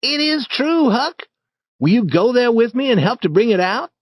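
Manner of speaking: put-on excited boy coaxing a friend; Tom.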